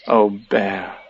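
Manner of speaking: low, soft affection; tender. A narrator's reading. Christopher Robin to Pooh after breakfast, tender. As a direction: sigh it fondly.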